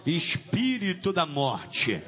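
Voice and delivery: low, slow address to a demon; low summons. Real, not acted. (invocação grave e lenta)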